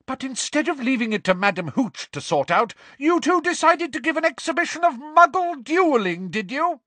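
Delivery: high, outraged tirade; incredulous, furious teacher; bellowed fury. Acted. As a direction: scold at full pitch. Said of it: "bellowed Professor McGonagall".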